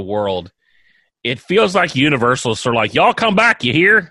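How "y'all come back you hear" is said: high, loud Southern drawl; cheery folksy Southern welcome; Southern accent. Retold or imitated. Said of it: "speaking of Paula Deen".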